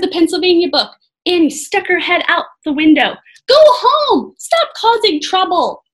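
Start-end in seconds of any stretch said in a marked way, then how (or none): 3.5-5.8 high loud yell. A narrator's reading. Annie yelling at the Vikings from the window. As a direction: shout them away. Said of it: "she yelled to the Vikings".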